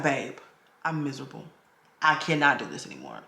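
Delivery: a quiet, beaten-down admission; weary, soft confession. Retold or imitated. Tells her husband she's done, softly and defeated. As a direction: let it sink low and soft, worn out.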